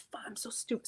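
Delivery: a quick, quiet grumble of self-blame; muttered self-scolding. Retold or imitated. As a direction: mutter it under your breath, fast and annoyed. Retold cursing at herself.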